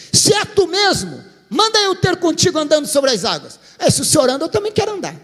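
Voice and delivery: high, eager plea to walk on water; bold eager call. Acted. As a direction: challenge him with daring excitement. Peter's daring challenge to Jesus.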